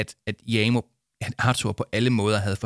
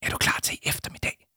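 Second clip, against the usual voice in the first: hushed question; whispering. Acted. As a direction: whisper it from hiding. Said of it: "hviskede Jama".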